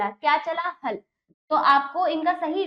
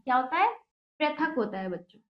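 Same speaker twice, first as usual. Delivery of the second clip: ordinary talk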